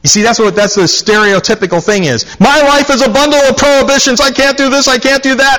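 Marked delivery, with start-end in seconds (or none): 2.4-5.6 whiny complainer. Retold or imitated.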